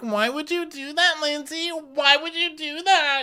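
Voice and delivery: very high, overdramatic reproach; mock wailing girl. Girl reproaching Lindsay in a quarrel, mock wail. Acted quarrel between friends.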